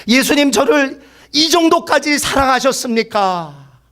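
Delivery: high, drawn-out mock cry to God; retold crying out. Young convert crying out to Jesus, imitated impassioned cry. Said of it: "이렇게 부르짖었대요".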